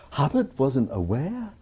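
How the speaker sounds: high, slow scandalised questioning; drawn-out, high disbelieving challenge; mock incredulity. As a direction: imitate stunned disbelief, pitch climbing. Officials' incredulous reply acted out.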